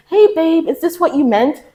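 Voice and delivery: high, mock-sweet question; sugary mock query; fake-sweet. Confronting a shady friend, mock sweet and high.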